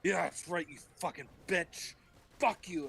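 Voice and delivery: high-pitched hammed-up cursing; mock angry taunt. Acting out Cain after the blow, mock anger. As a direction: curse in comic outrage. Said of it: "And he goes".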